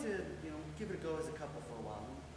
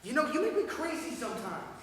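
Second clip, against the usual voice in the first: loud, higher-pitched frustration; fed up and raised in pitch; exasperated outburst; 8 semitones higher, 11 dB louder than usual. Acted. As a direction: burst out at your partner. Quarrel flares up again.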